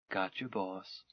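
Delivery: the craft's AI confirming the order; ship's computer voice. Acted. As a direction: answer like a breezy onboard computer.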